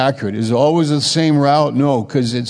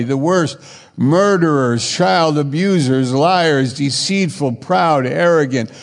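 rising preaching; 4 semitones higher than usual. Acted. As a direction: build the list to a passionate peak. Preaching builds to full force.

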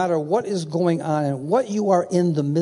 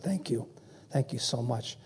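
soft, quiet retold thanks; hushed, understated gratitude; subdued gratitude; 3 semitones lower, 11 dB quieter than usual. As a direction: quietly voice a grieving man's thanks. Bereaved pastor's reply over the phone.

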